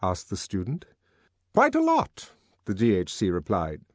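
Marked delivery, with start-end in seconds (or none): 1.5-2.3 high-pitched director's voice; director's reply. Acted.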